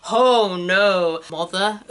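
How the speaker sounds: mock American attempt at an English accent, drawn out; bad English accent. Brit mocking Americans doing English accents, hammed-up bad English accent. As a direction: exaggerate a fake English accent on "Oh no, mother". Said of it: "Americans doing English accents that it's like".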